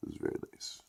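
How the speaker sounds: soft whispered praise. Real, not acted. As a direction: breathe it out in a whisper.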